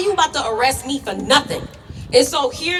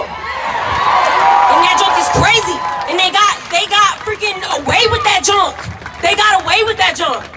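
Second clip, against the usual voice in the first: loud, higher, real outrage at the cops; furious shout; 6 semitones higher, 4 dB louder than usual. Real, not acted. Furious about police getting away.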